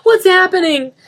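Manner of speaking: high, very loud frantic; frantic boy. Acted. Denny bursting into the room, frantic. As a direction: burst in scared.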